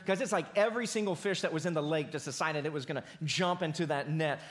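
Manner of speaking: normal talking voice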